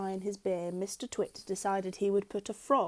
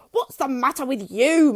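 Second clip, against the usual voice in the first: loud, higher gruff Mister Twit; gruff husband; 5 semitones higher, 11 dB louder than usual. A narrator's reading. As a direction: bark it at your wife. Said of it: "Mister Twit said".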